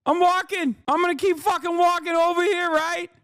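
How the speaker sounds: high Boston heckler voice, "Who are ya?" thrown out; mock Boston accent. Host mocking both Boston guys, high-pitched Boston accent. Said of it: "the masshole accent".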